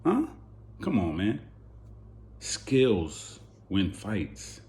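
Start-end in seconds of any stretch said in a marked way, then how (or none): none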